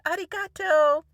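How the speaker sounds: loud, high mock thank-you; husband's hearty thanks. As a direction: imitate someone calling out thanks, oblivious. Re-enacts husband waving and thanking again.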